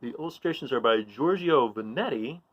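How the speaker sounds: ordinary delivery, nothing marked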